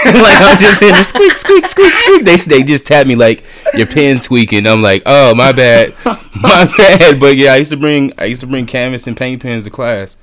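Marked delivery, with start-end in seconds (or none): 0.1-1.0 loud, high voice breaking into laughter; laughing loudly. Real, not acted. Laughing while retelling the class scene. 1.0-2.2 very high, loud squeaks like a paint pen; squeaky pen noise. Retold or imitated. Imitating the pen again, laughing. 2.2-8.1 louder and higher, laughter running through the words; laughing. Real, not acted. Still laughing through the retold apology.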